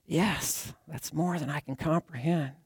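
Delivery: hushed words, barely keeping tears in; holding back tears. A narrator's reading. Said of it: "Holding back the tears".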